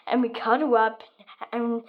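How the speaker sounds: plain reading voice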